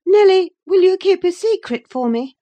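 high, loud pleading voice; high-pitched appeal to a confidante; Catherine. A narrator's reading. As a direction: plead in a high young lady's voice. Catherine begs her nurse's confidence.

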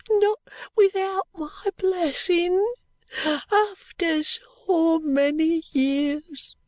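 very high, slow, tearful wail; anguished cry. A narrator's reading. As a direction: draw out each word in high-pitched grief. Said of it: "she cried".

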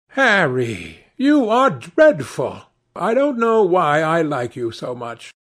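lightly acted youth, high and emphatic; chiding young Dorian. A narrator's reading. Narrator voices Dorian chiding Harry.

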